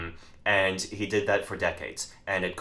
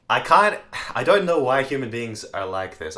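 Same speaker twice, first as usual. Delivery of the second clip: exasperated; 6 semitones higher, 7 dB louder than usual. Real, not acted. Exasperated outburst.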